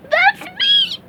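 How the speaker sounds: high, gleeful crow. Acted. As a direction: crow it like you've won. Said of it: "crowed SpongeBob".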